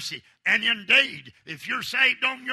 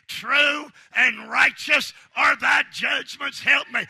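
preaching at full force; 3 semitones higher than usual. Acted. Preacher rousing the congregation about God's temper, shouting. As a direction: preach it hard to the crowd.

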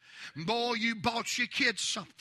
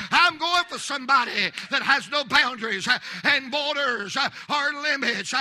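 preaching at full force; 3 semitones higher, 6 dB louder than usual. Real, not acted. Preacher to church, building to a loud shout. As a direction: climb to a fervent shout.

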